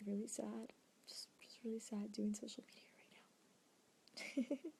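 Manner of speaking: slow, soft, breathy pretend sorrow; mock tearful sigh of a voice; acted sadness. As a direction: sink into a fake sad mood. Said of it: "Somebody told me to cry".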